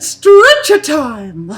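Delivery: high, loud, drawn-out crowing announcement; gleeful shout. Acted. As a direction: relish the threat, stretch every word out. Second captor gleefully announces stretcher.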